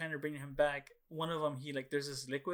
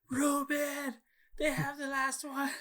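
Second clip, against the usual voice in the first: much higher, slow, thrilled call across the store; 12 semitones higher, 3 dB louder, slower than usual. Retold or imitated. To his brother Ruben across the store, high thrilled call. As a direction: call your brother over, overjoyed.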